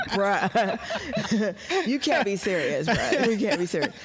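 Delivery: laughing through the words; can't keep a straight face; laughing disbelief. Retold or imitated. Laughing retort to the stranger.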